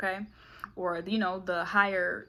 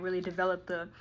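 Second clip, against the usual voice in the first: normal talking voice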